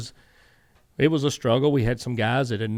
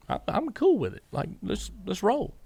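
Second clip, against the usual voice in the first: higher, easygoing retold reply; breezy reassurance; 8 semitones higher than usual. Telling Rick about the ownership split, breezy and higher. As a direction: brush it off like it's no big deal.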